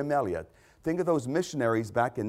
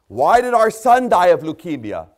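anguished cry; 3 semitones higher, 10 dB louder than usual. Acted. Preacher voicing grieving parents' cry.

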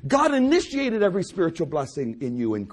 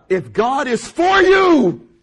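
loud, high, drawn-out cry on "for you"; ringing full-force proclamation; preacher's shout; 7 semitones higher, 10 dB louder, much slower than usual. Real, not acted. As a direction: belt the verse out slowly to the congregation. Preacher shouts the verse again.

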